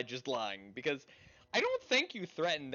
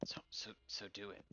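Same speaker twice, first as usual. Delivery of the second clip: whispering; 3 semitones lower, 12 dB quieter than usual. Real, not acted. Quiet urging at the table.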